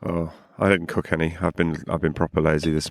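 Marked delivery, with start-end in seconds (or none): none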